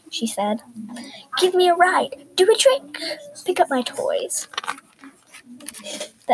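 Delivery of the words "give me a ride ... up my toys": put-on high, bossy child's orders; bossy demands. Spoilt girl ordering her elephant about, high and bossy. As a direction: rattle off commands like a spoilt brat.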